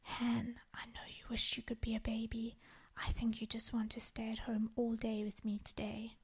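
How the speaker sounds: soft whisper close to the ear; gentle whisper. Retold or imitated. Mother whispering to daughter on her lap, gentle whisper. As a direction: breathe it tenderly into her ear. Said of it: "I whispered gently in her ear".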